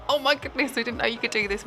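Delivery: normal talking voice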